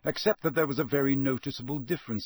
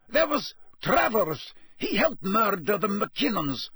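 anxious informer; 11 semitones higher than usual. A narrator's reading. Karkaroff naming names to the court, anxious. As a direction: blurt the names in a high, strained voice. Acted prisoner in narration.

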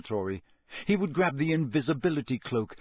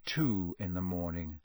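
weary; 8 semitones lower, 6 dB quieter than usual. A narrator's reading. Hours passing through the night.

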